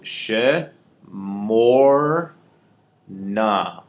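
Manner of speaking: "she mor na" in three slow, separated syllables. Shown as a demonstration. Teacher showing students how we would say this word, syllable by syllable. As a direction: draw out she, mor, na with clear pauses, full e in "she". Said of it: "the way we would say this word".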